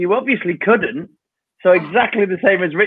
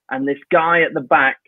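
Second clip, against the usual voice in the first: normal talking voice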